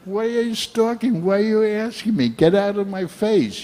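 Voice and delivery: high-pitched exasperated complaint. Retold or imitated. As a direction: squeal it out, fed up. Retelling being annoyed in India.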